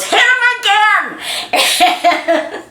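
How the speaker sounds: high, loud, slow cry through a laugh; excited shout. Retold or imitated. Little Dorothy cheering at the movie, a laughing high shout. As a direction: egg the hero on, laughing. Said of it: "Dorothy, I could remember, goes".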